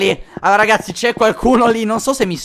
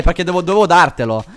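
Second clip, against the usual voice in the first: ordinary talk, nothing marked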